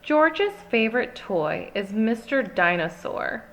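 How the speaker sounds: ordinary delivery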